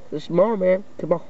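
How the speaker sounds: usual speaking voice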